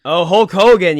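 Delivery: loud, high "oh" of recognition; suddenly remembering; surprised realization. Real, not acted. Sudden recall of answer.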